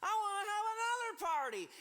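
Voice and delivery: high, loud party shout; excited drunk king. Acted. Drunk king Xerxes announcing another party, high excited shout. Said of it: "Xerxes looks around and he goes".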